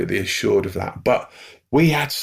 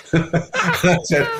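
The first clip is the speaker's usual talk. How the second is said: laughing; 8 semitones higher, slower than usual. Real, not acted. Laughing before the retort.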